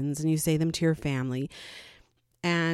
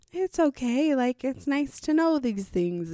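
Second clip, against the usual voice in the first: higher, gentle reassuring aunt; sweet reassurance; 7 semitones higher than usual. Retold or imitated. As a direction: soothe her kindly. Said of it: "my aunt is super sweet".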